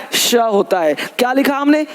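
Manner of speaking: ordinary delivery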